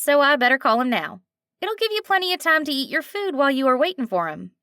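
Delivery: a kind waitress taking charge with a twang; eager nasal twang; lively twang. Acted. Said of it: "slight twang to it".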